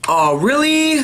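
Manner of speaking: high, loud indignant oh really; exaggerated disbelief; mock outrage. Real, not acted. Mock outrage at bad spin.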